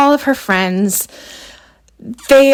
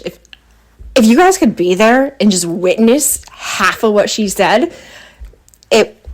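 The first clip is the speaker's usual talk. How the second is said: ordinary talk, nothing marked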